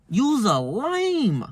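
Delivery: 1 contemptuous. Retold or imitated. To a customer obeying his wife, drawn-out scorn. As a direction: stretch it out slowly in disdain.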